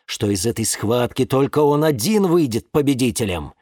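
ordinary delivery, nothing marked

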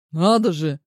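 ordinary delivery

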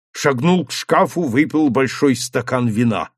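ordinary delivery

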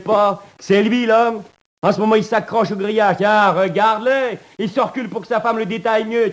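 voix très haute et forte, exaltée; exaltation fiévreuse. Acted. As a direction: crie ton délire vers le grillage. Prisonnier exalté désigne l'homme dehors. (voix aiguë et forte, exaltée)

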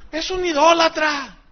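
high-pitched, imitated denunciation; hammed-up indignant verdict; mock accusation. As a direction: act out a scandalized pastor condemning someone. Retelling the pastor's accusation.

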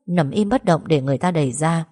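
usual speaking voice

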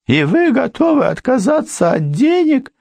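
very high astonished question; surprised. A narrator's reading. Housekeeper astonished at the lawyer, high surprised. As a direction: unable to grasp it. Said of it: "удивилась Мария".

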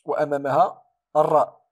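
normal talking voice

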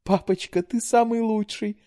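very high, slow adoring gush. A narrator's reading. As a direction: gush with adoration. Daughter hugs delighted father.

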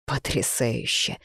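low, slow, soft bitterness; bitter. A narrator's reading. Man reacts to the pregnancy news, quietly bitter. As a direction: drop it low and drag it out bitterly. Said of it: "Горько рассмеялся мужчина".

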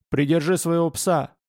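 firm, serious demand; understated stern warning. A narrator's reading. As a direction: put him on notice, seriously. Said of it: "серьёзно сказал Павел".

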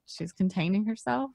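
usual speaking voice

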